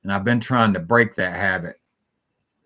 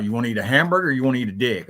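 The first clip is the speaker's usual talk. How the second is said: rapid-fire offer; 2 semitones higher, much faster than usual. Retold or imitated. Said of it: "then he would go on about".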